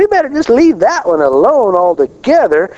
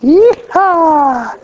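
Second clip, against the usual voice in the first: mock Southern whoop; 11 semitones higher than usual. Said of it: "working on my North Carolina accent".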